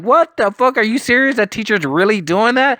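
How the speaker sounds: high, incredulous what the fuck; stunned disbelief. Retold or imitated. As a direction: pitch it way up in mock shock. Disbelief at friend's A grades.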